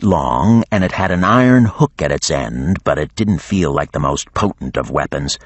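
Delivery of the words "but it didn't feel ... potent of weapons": ordinary delivery, nothing marked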